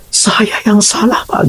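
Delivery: high, slow crying student's plea; imitating a crying student; tearful confession. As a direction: plead like a sobbing boy.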